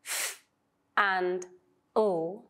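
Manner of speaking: "f" and "l" said apart as single sounds; separate sounds. Shown as a demonstration. Teacher breaking the blend into its parts. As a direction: split "f" from "l" cleanly. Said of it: "We've got".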